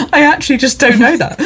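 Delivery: high mimicry through giggles; mock the admission while cracking up; laughing imitation. Host quoting contestant's funny answer back, laughing imitation. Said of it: "the way you said that was really funny".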